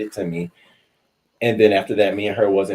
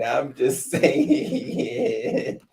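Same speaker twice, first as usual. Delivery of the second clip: laughing; 9 semitones higher, much slower than usual. Real, not acted. Teasing, breaks into laughter.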